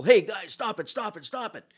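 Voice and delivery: high-pitched plea to stop, trailing off; calling out. Retold or imitated. Referee breaking up a scrum.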